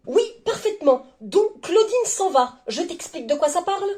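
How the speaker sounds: high, emphatic mock lecturing; pointed irritation. Reader lecturing the partner who interrupts, pointedly irritated.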